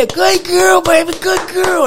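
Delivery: high, loud praise called across the room; very excited called praise. Retold or imitated. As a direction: cheer the puppy from afar. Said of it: "I'm just getting excited".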